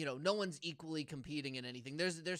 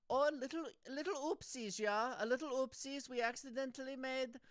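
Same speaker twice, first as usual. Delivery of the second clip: put-on high, playful German accent; botched German that sounds like Holland; mock German accent; 9 semitones higher than usual. German admitting a little mistake, high comic accent. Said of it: "that's a German".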